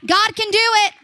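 loud, high cry of faith; preaching shout. Real, not acted. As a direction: proclaim it at full force. Preaching to church crowd.

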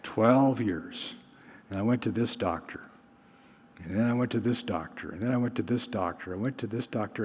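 low, soft, repetitive recital; long-winded storyteller. Retold or imitated. Woman telling Jesus her long story, lightly mimicked rambling. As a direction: imitate someone droning through a long story. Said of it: "she tells him this long story".

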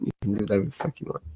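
fast, soft throwaway line; quick mumbled aside. Real, not acted. Host muttering to co-host, fast and soft. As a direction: rattle it off under breath.

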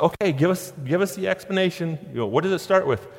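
a hint of a patient dad coaxing; coaxing parent. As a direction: coax her to tell, lightly voiced. Parents calmly coax daughter.

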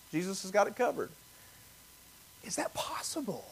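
breathy hushed whisper, barely voiced; whispered question. Real, not acted. Pastor whispering a wondering question to the congregation. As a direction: lean in and whisper it as a wondering thought.

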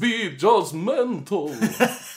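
slow, laughing vampire drawl; playful hammy count. Pretend. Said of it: "vampire voice you're gonna add".

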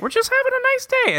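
high, sing-song mock voice; a hammed-up squeaky happy voice; mock cheerful. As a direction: chirp it like a cartoon. Mocking Six's cheerful day.

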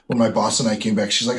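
usual speaking voice

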